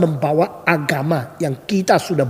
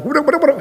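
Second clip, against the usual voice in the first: high, babbled imitation of Dutch; mock Dutch gibberish; 11 semitones higher than usual. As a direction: babble like an interpreter nobody understands. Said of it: "Lalu diterjemahkan bahasa Belanda".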